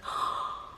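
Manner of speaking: retold gasp of astonishment; quick intake of breath in amazement; amazed gasp. Reacts to finding the card.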